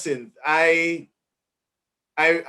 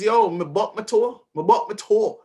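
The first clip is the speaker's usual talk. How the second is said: slightly higher, lively Jamaican patois quote; 6 semitones higher, 3 dB louder than usual. Retold or imitated. Host quoting the book's down-to-earth voice in Jamaican patois. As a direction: toss it off in patois, like telling a mishap. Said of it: "it is".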